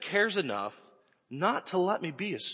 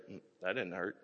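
put-on low, soft, unimpressed little girl; defiant toddler; 8 semitones lower, 12 dB quieter than usual. Toddler telling Cassie the swat didn't hurt, low and defiant. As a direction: brush it off like a stubborn toddler. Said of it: "She goes".

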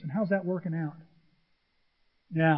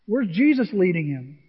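ordinary delivery

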